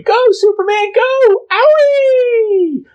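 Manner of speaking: put-on very high, loud kid cheering; excited kid. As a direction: squeal it like a screaming fan. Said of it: "One kid going".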